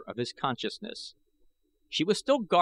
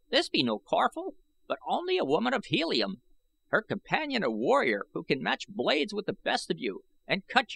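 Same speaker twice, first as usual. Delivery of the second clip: put-on high, reedy old man's voice, boastful; 10 semitones higher than usual. A narrator's reading. Old I Gos gloating to the young warriors, high and reedy. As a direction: crow about it like a proud old man.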